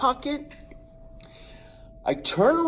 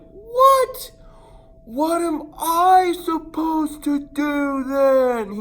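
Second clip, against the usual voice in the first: much higher, louder slow wail; panicked shout; 12 semitones higher, 6 dB louder, much slower than usual. Retold or imitated. The boy shouts at his cousin, much higher, louder and slower. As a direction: yell it in disbelief. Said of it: "I'm like".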